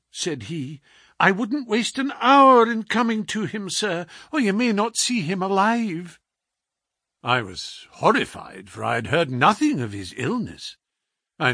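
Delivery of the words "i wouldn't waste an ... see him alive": a lightly raised, anxious landlady voice; worried housekeeper. A narrator's reading. Housekeeper telling the doctor the detective is dying, lightly anxious. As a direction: plead the news like a frightened housekeeper. Mrs Hudson voiced in story.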